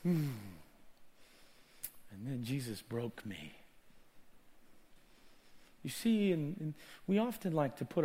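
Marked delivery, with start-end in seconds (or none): 2.1-3.5 low, soft confession; humbled hush. Real, not acted. Preacher confessing that Jesus broke him, hushed and low. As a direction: drop right down, subdued.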